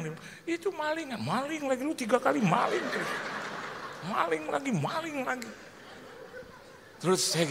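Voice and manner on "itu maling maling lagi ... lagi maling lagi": high-pitched mock griping; imitated complainer. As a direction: harp on it in a high put-on voice. Meniru keluhan soal pesan maling.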